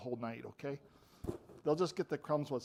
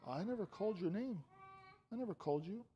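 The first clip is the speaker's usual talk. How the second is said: softer, higher-pitched puzzled denial; old priest Eli; 4 semitones higher, 7 dB quieter than usual. Acted. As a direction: play Eli gently denying he called. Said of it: "And Eli says".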